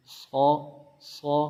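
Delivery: سو as a heavy, rounded "saw"; thickened sad. Shown as a demonstration. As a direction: fatten the s of سو with a dark open vowel. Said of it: "تو اس کو تھوڑا سا موٹا کر کے پڑھیں گے".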